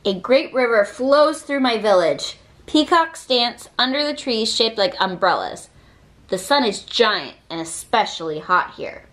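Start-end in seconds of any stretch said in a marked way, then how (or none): none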